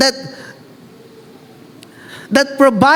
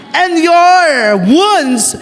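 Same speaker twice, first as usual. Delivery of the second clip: drawn-out emphasis; 7 semitones higher, 4 dB louder, much slower than usual. Acted. Preacher dwells on point.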